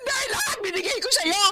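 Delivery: high, sobbing cry of reproach; crying outburst. Acted. Monica reproaching Dondi for all she gave, sobbing high. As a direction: wail it through tears. Monica confronts cheating partner.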